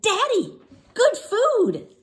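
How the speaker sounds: put-on high, loud childish plea; small girl proudly presenting ice cream; little girl voice. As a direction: sell it like a sweet little kid. Child pleads again.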